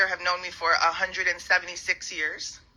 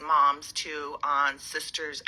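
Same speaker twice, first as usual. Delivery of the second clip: normal talking voice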